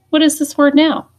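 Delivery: high, loud quiz question; excited question. Real, not acted. Teacher quizzing the class.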